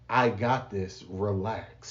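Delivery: very soft, low reassurance; God's gentle voice; soothing. Retold or imitated. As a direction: let it fall gently. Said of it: "God was able to be like".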